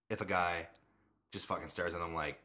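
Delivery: normal talking voice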